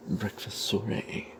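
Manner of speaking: usual speaking voice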